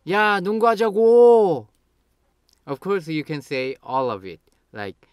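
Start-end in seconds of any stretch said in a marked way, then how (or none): 0.1-1.7 high, loud, insistent shout; angry. Shown as a demonstration. To a friend who ignores the invitation, angry. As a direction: shout it and stretch the final 고. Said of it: "Then you get angry and say".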